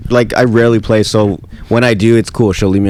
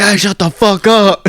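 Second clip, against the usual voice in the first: high, slow drawn-out mock yell; stretched-out mock outrage; imitated annoyed gamers; 9 semitones higher, slower than usual. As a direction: imitate a lobby fed up with noise. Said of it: "everyone's like".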